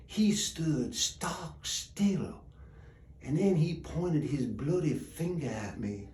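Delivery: soft, drawn-out storytelling; hushed, ominous narration; suspenseful hush. A narrator's reading. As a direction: drop the voice and let the dread hang. Sudden stillness in ghost story.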